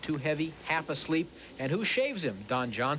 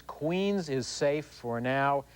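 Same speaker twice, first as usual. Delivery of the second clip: slow reassurance; much slower than usual. Real, not acted. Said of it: "I know you're worried".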